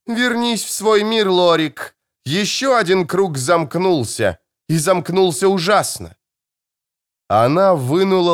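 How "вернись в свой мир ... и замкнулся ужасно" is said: sharp. Acted. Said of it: "её голос звучал резко".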